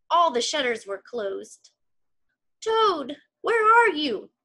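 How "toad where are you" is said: higher, louder searching call. Acted. Scene: Frog searching the dark house, calling. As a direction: call into a dark room for a friend. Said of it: "called Frog".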